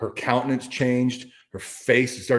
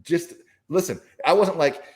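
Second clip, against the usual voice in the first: ordinary delivery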